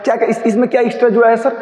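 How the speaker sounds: fast, high, eager student asking; a pupil blurting out a question; mock student question. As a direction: rush the question like a curious pupil. Teacher voices a student's question.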